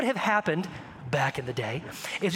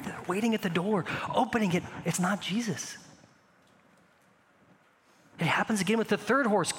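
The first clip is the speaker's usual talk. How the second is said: ordinary talk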